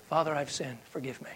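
quiet, unadorned plea; softly prayed, without any show; simple confession prayer. Acted. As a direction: let it fall away almost to nothing.

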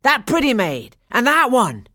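loud, high rough calls; pirate shouting at his men to grab the girls. A narrator's reading. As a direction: bark out the targets.

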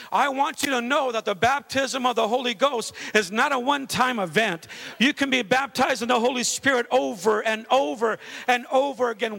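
loud, high, driving preacher's cry; full-force preaching. Acted. Pastor preaching hard to the congregation, loud and driving. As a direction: preach it out with fire to the church.

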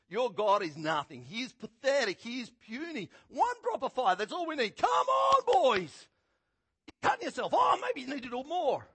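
loud, high scornful jeers; mocking taunt. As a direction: belittle their god with contempt. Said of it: "and he's mocking".

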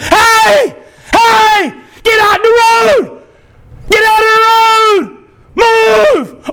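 high, urgent full-voice yell; screamed warning. Acted. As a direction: scream to get children out of danger. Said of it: "you scream at the top of your lungs".

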